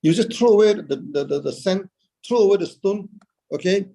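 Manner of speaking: ordinary talk, nothing marked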